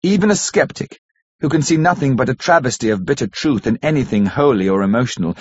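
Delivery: usual speaking voice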